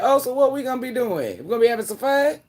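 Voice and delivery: high, eager mock-excited questions; excited questioning. Replaying own reply to friend's shorter work week, high excited questioning. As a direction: pitch it way up, teasingly eager.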